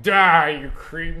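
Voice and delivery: sudden loud mock yell, then dropping off; hammed-up horrified shout; hostile outburst. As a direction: lash out in pretend disgust. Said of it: "rather than be like".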